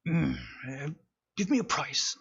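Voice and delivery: a put-on merchant voice rising up on the price; lightly voiced shopkeeper, cagey and haggling. Shopkeeper haggling with Rev over the helmet, cagey put-on voice. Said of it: "he looks at you and he just goes".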